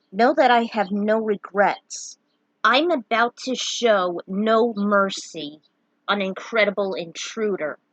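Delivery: ordinary delivery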